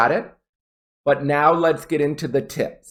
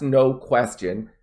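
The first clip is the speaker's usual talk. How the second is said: ordinary delivery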